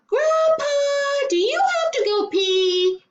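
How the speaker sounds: put-on very high, loud child calling down; yelling from upstairs. A narrator's reading. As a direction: call out to grandpa across the house. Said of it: "Andrew yelled".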